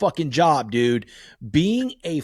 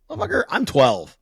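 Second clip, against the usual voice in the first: put-on louder and higher, a kid's indignant protest; mock outburst; 6 semitones higher, 4 dB louder than usual. Kid talking back to dad, mock indignant outburst. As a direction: throw it back with mock outrage.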